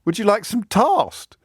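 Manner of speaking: high and slow, "toast" as "tarst"; posh toast vowel. Acted. Headmaster's wife repeating her offer, "toast" as "tarst". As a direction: repeat it slowly with a long AH in "toast". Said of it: "Who says toast?".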